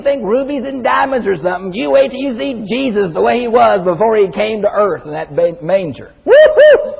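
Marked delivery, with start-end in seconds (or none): 6.2-6.9 high joyful whoop with a laugh; gleeful woo-hoo. Real, not acted. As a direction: whoop for joy. Preacher whoops with joy.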